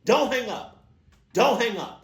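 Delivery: high, loud frantic hold on, hold on; loud hammed-up "hold on, motherfucker"; heated yell. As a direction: yell down the phone to stop him hanging up. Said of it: "I would've said".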